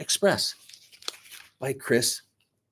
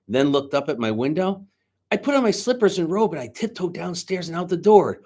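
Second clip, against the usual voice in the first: lively read-aloud storyteller voice; story narration; 6 semitones higher, 4 dB louder than usual. A narrator's reading. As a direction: read the story to the class with energy. Teacher reads story aloud.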